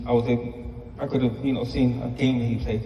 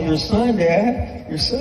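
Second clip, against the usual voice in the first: put-on higher, insistent childlike voice; imitating insistent kid; 8 semitones higher, much slower than usual. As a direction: insist like an exasperated little boy. Son insisting to teasing dad.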